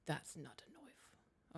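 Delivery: slow, whispered Australian accent; hushed Aussie movie line, "knife" as "noif". Shown as a demonstration. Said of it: "I was just trying to get the accent".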